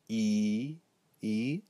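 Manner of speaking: "E" held as a long ee; long I sound. Shown as a demonstration. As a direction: stretch "E" into a lengthened ee. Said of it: "a longer I sound".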